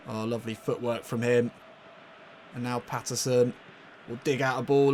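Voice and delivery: ordinary delivery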